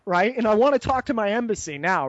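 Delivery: ordinary delivery, nothing marked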